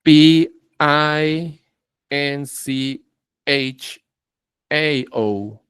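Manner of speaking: "P I N C H A O" spelled slowly letter by letter; slow spelling. Shown as a demonstration. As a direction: spell each letter out clearly. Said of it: "Another one". (deletreo pausado)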